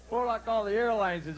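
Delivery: ordinary talk, nothing marked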